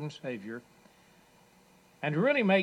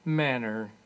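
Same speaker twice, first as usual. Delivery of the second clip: strained slurred; 9 semitones higher, 7 dB louder, much slower than usual. A narrator's reading. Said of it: "in his strained, slurred manner".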